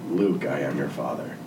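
deep, gravelly Vader voice. Shown as a demonstration. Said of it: "Can you say".

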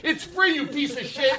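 very high, hammed-up outrage; shriek-like comic indignation at a customer; mock aggressive. Host to customer at ice cream truck, mock aggressive. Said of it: "So you take it aggressively".

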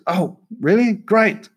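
retold "Oh, really? Great." pitched high in mock relief; mock delight. Telling the interviewer how he answered Spielberg, in high mock delight. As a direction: play up the fake delight at being let off the accent. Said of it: "I said".

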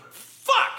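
very high, strained curse; mock outburst in the cereal aisle; frustrated yell. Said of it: "the cereal aisle going".

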